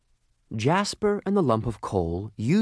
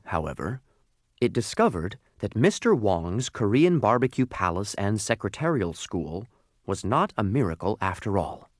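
usual speaking voice

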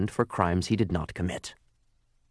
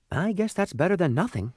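high little coal voice, settling for it; small high voice, resigned; resigned lump of coal; 9 semitones higher than usual. Acted. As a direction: accept half-heartedly, pitched well up.

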